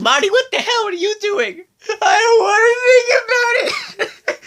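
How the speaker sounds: high, hammed-up blubbering wail, laughing through it; mock crying. Fan echoing the sobbing cartoon character, laughing: mock crying wail. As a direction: wail it like an overgrown crybaby, cracking up.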